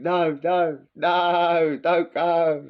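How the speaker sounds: loud, high hammed-up plea, no no no; hammed-up panicked goat begging him to stay away; mock goat pleading. The goat seeing him coming, a loud hammed-up begging cry.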